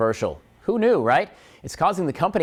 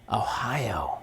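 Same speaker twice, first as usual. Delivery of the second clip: whispered guess to herself; soft unvoiced musing; 5 semitones lower, 7 dB quieter than usual. Real, not acted. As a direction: breathe the answer under her breath. Musing over quiz answer.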